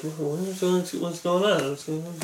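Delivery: hushed, confused crowd murmur, fading away; imitated murmuring. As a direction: murmur it under your breath, trailing into nothing. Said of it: "everyone in the theater starts murmuring".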